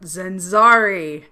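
booming, slow dragon roar. Pretend. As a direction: shout it like a huge dragon. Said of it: "summon my deepest dragon voice and shout".

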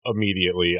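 ordinary talk, nothing marked

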